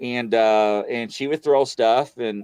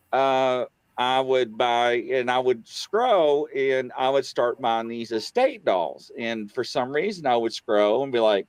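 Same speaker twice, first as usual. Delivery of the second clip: ordinary talk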